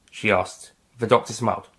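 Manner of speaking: ordinary delivery, nothing marked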